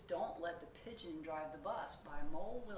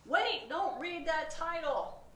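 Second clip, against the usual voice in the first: loud high slow pigeon protest; alarmed protest; 11 semitones higher, 13 dB louder, slower than usual. Acted. Pigeon begs the reader not to read the title, alarmed. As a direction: cry out to stop the reader.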